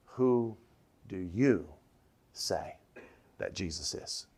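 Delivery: word by word, YOU set apart, sinking low and soft; pointed singular you. Acted. Preacher putting the question to each listener alone, pointed and hushed. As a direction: pause between words, lean on "you", fade away.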